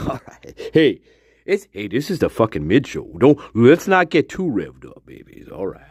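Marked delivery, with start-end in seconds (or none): none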